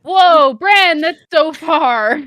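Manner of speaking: high, loud, slow whoa of disbelief; loud surprised reaction; amazed. Real, not acted. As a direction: stretch it out in shock. Reacting to a two-hour drive.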